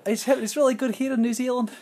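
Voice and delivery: high-pitched, badly faked New Zealander; mock Kiwi accent. Friend retells phone call from New Zealand, mock Kiwi. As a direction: imitate a strong Kiwi accent, amused. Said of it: "it was so strong".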